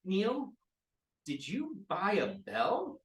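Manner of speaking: imperious mistress voice; villain asking henchman. Acted. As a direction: call him over like a bored queen. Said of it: "she turns and looks around to her henchmen".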